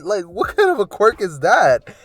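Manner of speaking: high, loud incredulous question; baffled outburst, voice shooting up; can't believe how silly it is; mock disbelief.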